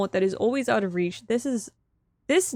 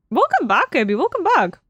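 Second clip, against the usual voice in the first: higher, louder greeting; 4 semitones higher, 4 dB louder than usual. Real, not acted. Streamer welcomes a returning item, high and delighted.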